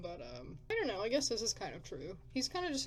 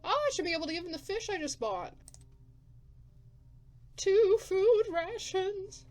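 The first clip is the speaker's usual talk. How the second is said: excited; 11 semitones higher, 8 dB louder than usual. Real, not acted. Pleased with gift choice.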